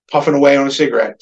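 usual speaking voice

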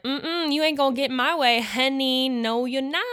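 high, loud, sassy put-down of stress; sassy defiance. Retold or imitated. Talking back to her own stress before the pageant, sassy and loud. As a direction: tell stress off with a finger-wagging "honey". Telling stress it won't win.

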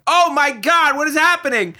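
high, loud, silly yelling at the dog; stupid voice. Retold or imitated. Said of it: "stupid voice".

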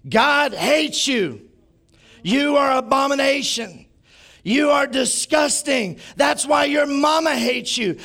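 imitating a raging protester; mock street preacher shouting. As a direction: bellow condemnation like a street preacher. Retelling street preacher shouting condemnation.

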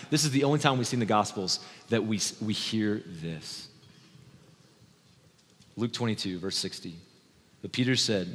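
ordinary talk

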